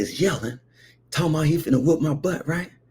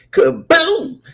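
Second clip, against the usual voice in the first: high, loud "kaboom"; cartoonish impact exclamation; explosive mock hit; imitated blow sound; 10 semitones higher, 6 dB louder than usual.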